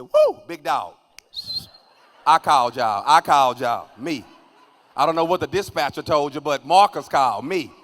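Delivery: loud indignant protest. Retold or imitated. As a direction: protest loudly to the cop. Protesting to police.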